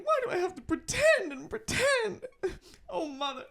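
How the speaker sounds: high, loud, tearful Southern belle wail; theatrical crying line read in a transatlantic lady accent; Scarlett O'Hara. Acted. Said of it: "I'm gonna do one Scarlett O'Hara line read".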